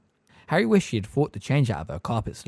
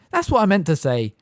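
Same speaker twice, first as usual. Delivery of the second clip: quick; 8 semitones higher, 6 dB louder than usual. A narrator's reading. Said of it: "said Miss Fig quickly".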